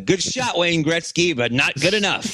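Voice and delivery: high-pitched, taunting mock goalie; play-acted goalie taunt. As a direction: tease the shooter like a cocky goalie. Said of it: "like a goalie".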